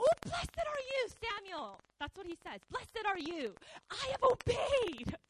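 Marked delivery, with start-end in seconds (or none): 0.0-1.8 loud, high gushing greeting; excited. Retold or imitated. Proud Saul greets Samuel with excited gushing. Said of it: "Saul is so excited". 2.7-5.2 high, loud, slow proud boast; excited boast. Retold or imitated. Saul boasts to Samuel, loud and excited. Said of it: "Saul is so excited".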